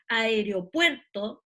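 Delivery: "Aeropuerto" drawn out slowly, stress on PUER; teacher's slow model of "Aeropuerto", PUER stressed; tonic syllable demo. Shown as a demonstration. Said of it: "ahí identificamos la sílaba tónica".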